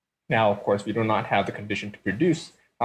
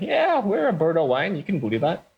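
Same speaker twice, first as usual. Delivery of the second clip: mock grand claim; 6 semitones higher than usual. Selling fake Bordeaux image.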